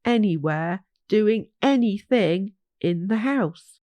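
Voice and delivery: plain reading voice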